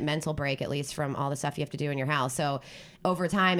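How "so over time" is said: normal talking voice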